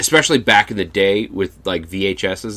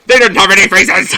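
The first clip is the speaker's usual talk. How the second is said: much higher, louder shrieked line; shrieked punchline; 12 semitones higher, 9 dB louder than usual. Retold or imitated. Friend repeats the ghost story ending, shrieking. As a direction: shriek it like the scary story teller. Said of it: "like, the".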